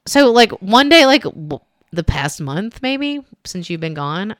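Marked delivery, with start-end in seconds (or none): none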